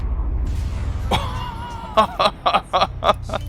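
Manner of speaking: high breathless wheezing laugh. Real, not acted. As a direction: wheeze through helpless laughter. Laughing after hurting himself.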